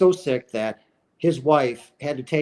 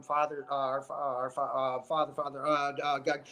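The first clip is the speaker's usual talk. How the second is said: soft, halting mumbled prayer; groping for words; 9 dB quieter than usual. Retold or imitated. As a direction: search for a half-remembered prayer, under your breath. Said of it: "he's trying to think".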